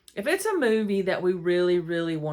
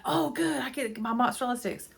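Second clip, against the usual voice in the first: higher, happy little cheer; delighted; 6 semitones higher than usual. Retold or imitated. Telling the counter staff she gets her snack, high gleeful. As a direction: play your own glee at the counter. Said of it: "And I'm like".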